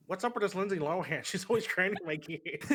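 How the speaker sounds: put-on animated dad impression; Peter Griffin. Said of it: "Peter Griffin. That's about it".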